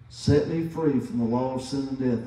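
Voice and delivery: ordinary talk, nothing marked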